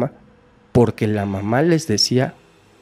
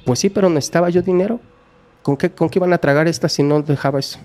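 higher, louder and fast, shamelessly self-justifying; brazen defiance; 8 semitones higher, 3 dB louder, faster than usual. Retold or imitated. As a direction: throw the excuse back at them. Mother defiantly justifies abuse. (madre descarada se justifica ante la policía, con tono alto y desafiante)

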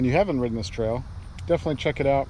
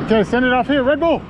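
excited; 9 semitones higher, 3 dB louder than usual. Real, not acted. Hyping a jump on the trail.

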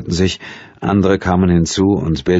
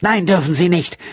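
high, sharp refusal; curt angry refusal; 12 semitones higher than usual. A narrator's reading. Angry woman refusing the detective's question. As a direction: shut him down angrily. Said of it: "zornigen Handbewegung".

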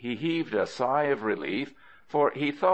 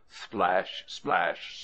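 plain reading voice

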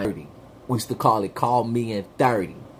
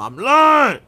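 shouting; 12 semitones higher, 6 dB louder than usual. Acted. Shouted admission.

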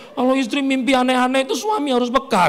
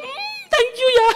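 high, slow mock squeal of thanks; hammed-up delighted thank-you; gushing thanks; 4 dB louder, slower than usual. Wife receiving a present from her husband, high gushing thanks.

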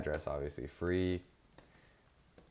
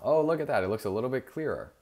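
pleased surprise; 7 semitones higher, 8 dB louder than usual. Real, not acted. Reacting to fixed border look.

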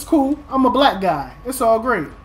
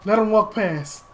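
usual speaking voice

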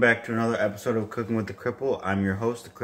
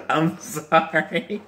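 laughing; 5 semitones higher, much slower than usual. Real, not acted. Apologizing while still laughing.

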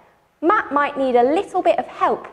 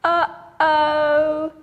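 uh-oh. Shown as a demonstration. Audience refrain.

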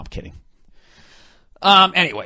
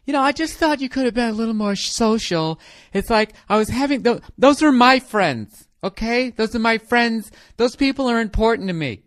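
put-on higher, whiny nagging girlfriend voice; nagging wife; 6 semitones higher, 4 dB louder than usual. Girlfriend nagging Donald about ignoring her friends, higher whiny voice. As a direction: plead and scold him at once, pitched up. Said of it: "she's just fucking sitting there nagging him".

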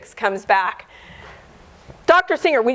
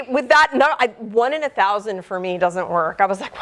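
ordinary talk

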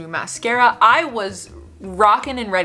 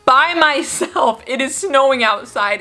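dramatic self-pity; 5 semitones higher than usual. Real, not acted. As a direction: drag out the pity for yourself. Peeing alone in a snowy park.

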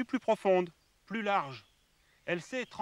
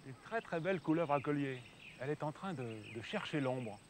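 hushed, soft voice; speaking low; 3 semitones lower, 6 dB quieter than usual. Real, not acted. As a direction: keep it down near the snake. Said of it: "je parle tout bas".

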